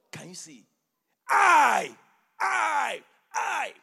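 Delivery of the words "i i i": each I shouted high and loud; "I" cried out three times, much higher and louder; hammered I. Shown as a demonstration. Preacher mocking the rich man's selfishness, shouting each "I". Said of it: "No kingdom emphasis".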